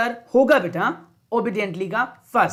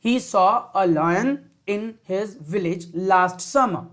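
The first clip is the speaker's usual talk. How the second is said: very slow, every word clear; slow model reading; 2 semitones lower, much slower than usual. Shown as a demonstration. Teacher to class, modelling the answer slowly. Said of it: "मैं जो बोल रहा हूं उसको ध्यान सुनेंगे".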